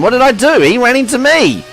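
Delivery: high, loud indignant yelp; outraged protest. Real, not acted. Gamer yelling at the rival who rammed him, high and loud. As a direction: cry out at the car that hit you. Racer rammed by another car.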